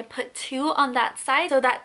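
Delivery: speaking with lip pulled aside; finger at mouth. Real, not acted. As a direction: fingertips touching lips. Seen 1: Fingers at lips pulling them back to show braces and bands.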